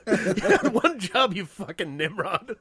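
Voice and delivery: slow mock scolding, breaking into laughter; laughing scold. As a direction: drag out the words while cracking up.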